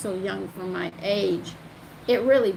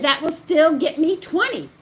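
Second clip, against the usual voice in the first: louder, higher exasperated reply; retold insistent brush-off; firm refusal; 7 semitones higher, 7 dB louder than usual. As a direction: refuse once more, emphatically. Acted refusal, repeated and escalating.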